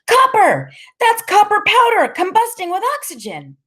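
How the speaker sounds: put-on very high, loud kid's yell; piercing, exhilarated shouting; excited scream. A narrator's reading. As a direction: scream it out, thrilled, high in your voice. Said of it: "Freak tugs my hair and screams".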